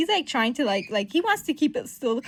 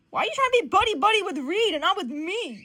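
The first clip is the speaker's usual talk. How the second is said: higher, emphatic mock whine; jealous complaint; 9 semitones higher, 4 dB louder than usual. Johnny jealous of friendship with Reed, indignant complaint. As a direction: plead in comic indignation.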